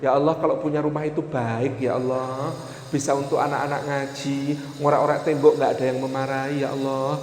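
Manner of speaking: low, soft acted prayer; praying husband. Husband prays for a house while wife cries, hushed humble prayer. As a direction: plead quietly to God. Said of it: "suaminya berdoa".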